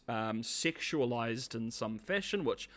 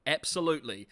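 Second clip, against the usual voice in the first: ordinary delivery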